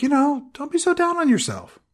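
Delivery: a falsetto pep talk for a gloomy vampire; mock consoling. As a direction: coo at him like a worried friend. Mock comforting Dracula.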